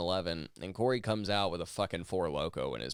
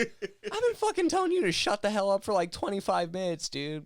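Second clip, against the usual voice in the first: high, loud rant on the edge of laughter; mock-furious, half-laughing outburst; retold exasperation; 13 semitones higher, 4 dB louder than usual. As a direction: re-enact losing patience, pitch way up. Retold outburst at drunk friend.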